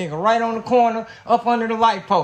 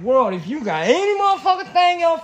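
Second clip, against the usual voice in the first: higher-pitched challenge to the whole world; retold scream; 4 semitones higher, 3 dB louder than usual. Man daring the world after a bad day, retold scream. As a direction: throw out a mock-yelled dare, pitched up. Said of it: "I screamed. I'm like".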